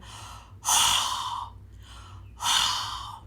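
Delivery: long hissing blow; dragon breath exhale. Shown as a demonstration. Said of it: "Blow fire at each other".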